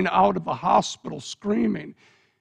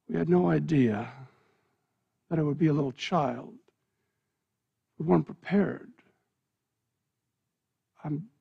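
softer, lower, heavy regret; grave apology; 3 semitones lower, 5 dB quieter than usual. Acted. The old doctor tells the parents the truth, softer and sorrowful. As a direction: break terrible news gently.

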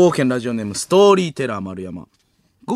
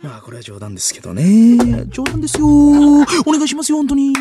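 climbs to high, loud whiny pleading; Soshina's flippant follow-up; 12 semitones higher, 7 dB louder than usual. Acted. Comedian lightly excusing his cruel joke, high pleading impression. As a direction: brush it off, voice shooting up. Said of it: "軽すぎるフォローを入れる".

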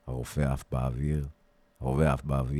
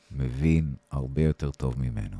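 ordinary delivery, nothing marked

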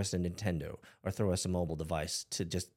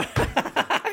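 high unvoiced giggle; burst of laughter; 9 dB louder than usual. Real, not acted. Host laughing at dropped-kid confession, high giggle. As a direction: crack up at the confession.

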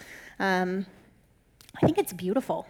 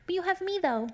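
high, soft, cutesy imitation; mock clueless partner; 7 semitones higher, 5 dB quieter than usual. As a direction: parody a partner's useless comfort, high and quiet. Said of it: "the answer is not".

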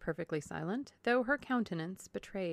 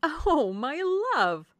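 high, loud, drawn-out endearment; gushing wifely cry; cried out; 9 semitones higher, 9 dB louder, much slower than usual. A narrator's reading. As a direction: coo it loudly across to a husband. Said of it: "cried Missus Palmer to her husband".

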